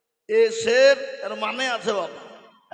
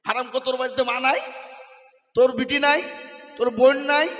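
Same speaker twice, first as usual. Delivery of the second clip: angry rebuke; 2 semitones higher, 8 dB louder than usual. Real, not acted. Preacher scolding youths.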